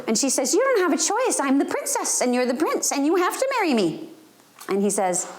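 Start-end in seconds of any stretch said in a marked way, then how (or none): 0.5-4.1 high, insistent little princess; bossy little girl. Retold or imitated. Niece bossing her brother, high bossy little girl voice. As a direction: stamp your foot as a bossy four-year-old.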